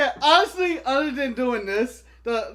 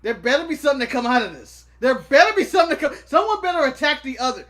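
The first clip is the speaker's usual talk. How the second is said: pitched up, insistent retelling; demanding quote, retold; 5 semitones higher, 5 dB louder than usual. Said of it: "I was like".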